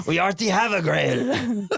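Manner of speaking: high mock Frenchman; French taunter accent. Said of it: "The Frenchman claims".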